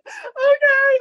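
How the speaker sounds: high, loud crying okay; tearful okay. Retold or imitated. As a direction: squeak it out through tears. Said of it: "standing there sobbing".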